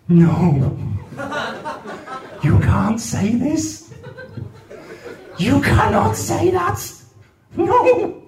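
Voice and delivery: the brain squeaking in horror; panicked brain voice. Acted.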